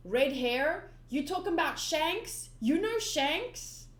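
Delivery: put-on bright eager young pirate, pushing; eager Luffy.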